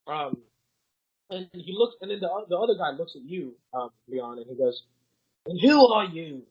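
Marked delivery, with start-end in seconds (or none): 5.5-6.4 lightly voiced guard, louder on the challenge; a hint of a suspicious guard; questioning guard. Guard questions an unknown person.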